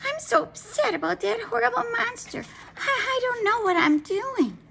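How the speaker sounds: high, fretful wife voice; upset and nervous. A narrator's reading. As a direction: stammer it out, rattled by the monster. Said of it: "she nervously slipped".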